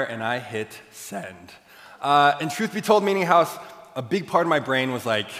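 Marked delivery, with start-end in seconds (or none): none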